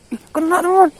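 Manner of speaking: high, loud thrilled cry; loud and high with delight; excited. Real, not acted. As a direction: yelp with joy at the catch. Fish bites suddenly.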